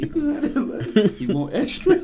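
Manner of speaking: high, loud, laughing through it; mock overly nice server; laughing offer. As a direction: giggle while offering. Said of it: "I said".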